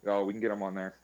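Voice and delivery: ordinary talk, nothing marked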